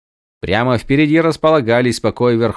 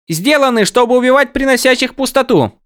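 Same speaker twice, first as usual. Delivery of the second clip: high excited voice; high character voice; 5 dB louder than usual. Acted. Windel's take, high.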